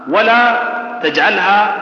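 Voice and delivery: ordinary delivery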